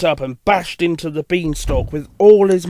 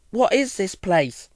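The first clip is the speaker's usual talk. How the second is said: lightly voiced curious engine, higher pitch; curious little engine; 4 semitones higher, 3 dB quieter than usual. Character asks in read story.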